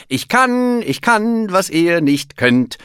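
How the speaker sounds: high, loud cheer of triumph; jubilant. A narrator's reading. Bear boasting to his friends, jubilant. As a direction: crow it gleefully, drawing out the boast. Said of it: "jubelte Berti Bär".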